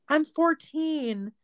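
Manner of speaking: put-on high, slow, small girl voice; imitating young girl. Fourteen-year-old chatter telling her age, slow and high. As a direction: drawl it like a young teen. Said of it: "And she goes".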